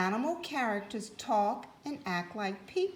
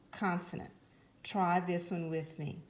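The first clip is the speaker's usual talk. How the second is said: normal talking voice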